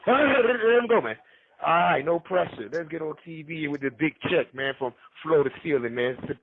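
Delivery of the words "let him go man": laughing. Real, not acted. Laughing repeat to a friend.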